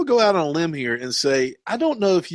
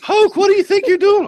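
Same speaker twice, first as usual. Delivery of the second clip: high, loud put-on old lady scolding; mock outraged high-pitched voice; indignant passenger; 5 dB louder than usual. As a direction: squawk at your driver in alarm. Acting out Miss Daisy scolding Hoke.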